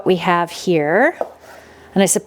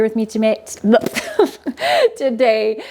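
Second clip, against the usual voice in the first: flub the word, burst into a high laugh, correct it; tongue-tied slip with a laugh; stumbling over words; 4 semitones higher than usual. Real, not acted. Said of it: "I am stumbling over my words".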